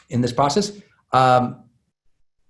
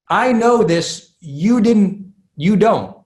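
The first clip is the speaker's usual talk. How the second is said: mock smug lecturing, pitched up and slowed; condescending know-it-all; 5 semitones higher, much slower than usual. Speaker imitating his own past tone to listeners, mock condescending. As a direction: talk down to them like you know better. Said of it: "that it could sound condescending".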